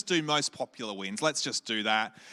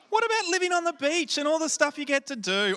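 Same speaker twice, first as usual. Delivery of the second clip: mock protest; 9 semitones higher than usual. Real, not acted.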